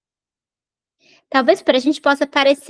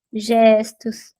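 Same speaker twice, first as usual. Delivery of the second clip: whispered; 3 semitones lower than usual. Shown as a demonstration. Teacher whispers "gestos" to the class to show the gestures, whispered.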